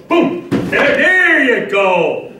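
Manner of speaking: high call-out, then a drawn-out "there you go"; excited praise. Real, not acted. Coach praising a player's hips on the drill, excited. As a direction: cheer the rep on, stretching the praise.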